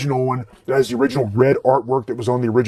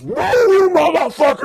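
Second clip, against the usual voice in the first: Doctor Death scaring off the stranger, high screamed threat; screaming. Retold or imitated. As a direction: scream it in his face. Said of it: "in that guy's face screaming".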